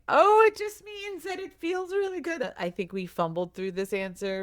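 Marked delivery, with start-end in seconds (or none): 0.1-2.5 much higher, fumbling cheery parent explanation; covering embarrassment with a bright brush-off; flustered parent explaining. Retold or imitated.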